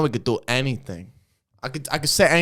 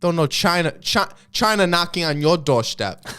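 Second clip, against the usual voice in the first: put-on Chinese accent; 4 semitones higher, 5 dB louder than usual. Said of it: "We're not bad at Chinese accents".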